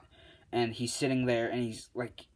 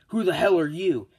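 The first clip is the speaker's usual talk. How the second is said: loud, high demand; acted alarmed shout; startled challenge; 6 semitones higher, 8 dB louder than usual. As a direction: confront a sudden intruder. Endeavor confronts visible ghost.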